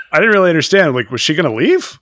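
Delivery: normal talking voice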